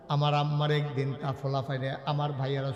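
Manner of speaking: usual speaking voice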